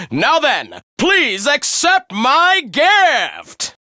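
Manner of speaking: high, drawn-out prize-show announcer; grand winner announcement. Acted. Host names the top three hunters, grandly announcing. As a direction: proclaim each name with a big flourish.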